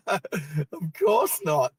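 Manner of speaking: drawn out very slowly through laughter; laughing resignation. Real, not acted. Told the cart opens Tuesday, slow laughing disbelief. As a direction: say it half-crying with laughter, slowly.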